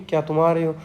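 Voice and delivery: normal talking voice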